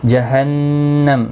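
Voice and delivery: slow جہنم with a nasal held نّ; tajweed demo. Shown as a demonstration. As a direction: hold the nasal ghunna in جہنم. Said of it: "نون مشدد پر غنہ ہوگا".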